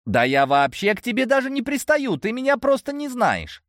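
a hint of a teasing young woman; lightly voiced girl. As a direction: voice the chatty elf girl lightly. Narrator voices the elf girl.

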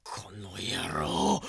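whispered menace; menacing hiss. Acted. A thug hisses in anger at Hol Horse. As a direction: hiss the threat.

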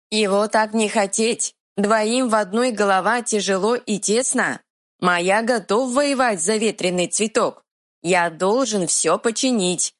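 simple-minded troll, earnest. Acted. Troll speaks in broken Russian.

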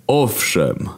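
wounded divine authority; God. Acted. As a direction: speak down from heaven, aggrieved. God confirms.

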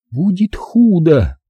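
high, drawn-out, pensive repeat; pensive echo. A narrator's reading. Zaza repeats the warning, pensive. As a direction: repeat it slowly, lost in thought, pitched high. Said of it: "задумчиво повторила Заза".

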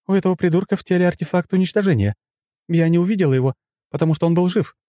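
high, urgent female character voice; alarmed female companion. A narrator's reading. Female companion warning of a hidden artifact, alarmed. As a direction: rush out the warning at a high pitch. Lora urgently explains the danger.

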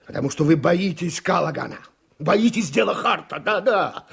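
angry accusation. Acted.

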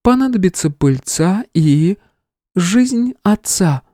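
high, slow divine voice; goddess. A narrator's reading. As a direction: speak as a calm deity. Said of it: "продолжила богиня".

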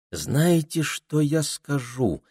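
high, slow, musing; thoughtful. A narrator's reading. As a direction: draw it out as if pondering. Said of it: "задумчиво проговорила Пенелопа".

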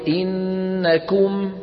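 إنكم chanted slowly with a clean "-kum"; long hummed n, then a pure u in إنكم; correct pure damma. Shown as a demonstration. Teacher shows what one must say, pure damma. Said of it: "عليه أن يقول".

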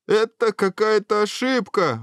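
high-pitched, dismayed outcry; exclaiming. A narrator's reading. Prince entering the castle, dismayed exclamation. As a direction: exclaim in theatrical alarm. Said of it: "воскликнул принц".

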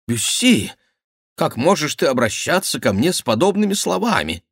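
high, wounded reproach; indignant protest. A narrator's reading. Duke reproaches Bussy for his words, high and indignant. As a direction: exclaim in offence, pitch leaping up.

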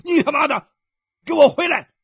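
very high, loud bellow; furious roar. Acted. Husband orders his wife indoors, roaring. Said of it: "吼道".

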